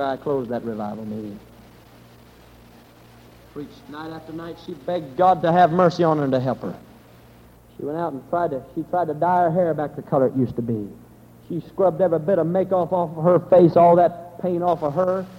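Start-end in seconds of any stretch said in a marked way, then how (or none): none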